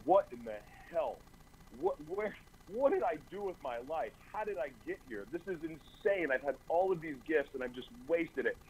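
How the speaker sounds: high, strained disbelief; lightly acted cry of despair; retold despair. Alone in an isolation cell, asking what he did with his life, in high disbelief. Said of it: "I'm sitting there going".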